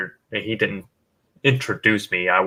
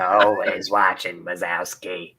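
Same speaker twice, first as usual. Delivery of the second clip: Roz impression; 2 semitones lower, 4 dB quieter, slower than usual. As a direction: do Roz, slow and flat. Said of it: "Roz from, uh, Monsters, Inc".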